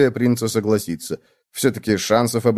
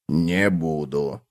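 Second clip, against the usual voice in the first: low, slow, stolid refusal; low, soft, immovable cockroach voice; firm cockroach; 6 semitones lower, 5 dB quieter, much slower than usual. A narrator's reading. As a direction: dig in quietly, low and drawn out. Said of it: "твёрдо ответил таракан".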